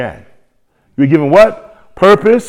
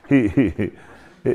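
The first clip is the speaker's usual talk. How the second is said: real chuckle breaking through; laughing at her own joke; 3 semitones lower than usual. Real, not acted.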